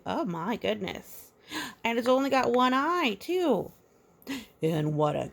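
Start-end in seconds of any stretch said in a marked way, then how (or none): 0.1-3.7 high, fast, louder surprise; surprised. Real, not acted. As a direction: gasp at the picture. Surprised at picture.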